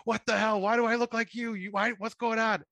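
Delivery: high-pitched imitation of someone panicking; freaking out. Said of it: "freaking out".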